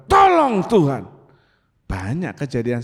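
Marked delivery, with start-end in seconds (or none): 0.1-1.1 high, loud call, falling; acted plea hurled out in danger; urgent cry for help. Driver in danger calls to God, urgent cry. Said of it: "seperti saudara bilang".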